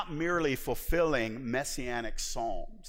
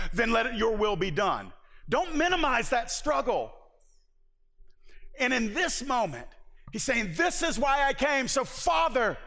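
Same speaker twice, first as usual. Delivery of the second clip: preaching at full force; 8 semitones higher than usual. Acted. Preacher to the congregation, building to a full-force peak. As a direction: pour it out from the pulpit.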